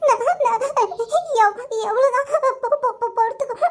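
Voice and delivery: choking on tears, words breaking apart; sobbing and stuttering, barely able to finish; crying. Acted. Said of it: "ஒண்ணு அழாம பேசு".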